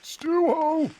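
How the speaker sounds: high, long answering call; calling back. Acted. Calling out to each other.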